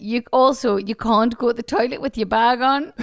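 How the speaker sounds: normal talking voice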